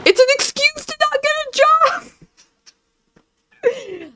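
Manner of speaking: mocking high voice. Mocking imitation of critic.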